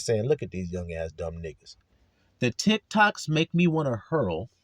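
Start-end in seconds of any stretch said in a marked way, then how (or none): 0.2-1.8 a lightly voiced grumbling old man, lower and softer; grumpy old man. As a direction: mutter it like an old man at the window. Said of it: "like an old ass man looking out my blind".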